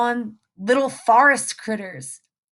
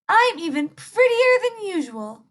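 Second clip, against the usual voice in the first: put-on much higher, preening little girl; vain girl voice; 8 semitones higher than usual. Little Red admiring herself in puddle, high preening.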